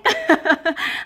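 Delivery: loud, high burst of laughter. Real, not acted. Speaker amused by own sound word, laughing. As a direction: crack up briefly, loud and high.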